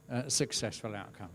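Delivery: usual speaking voice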